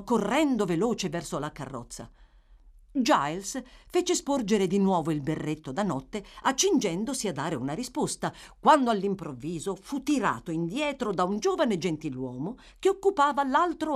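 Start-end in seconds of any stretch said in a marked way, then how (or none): none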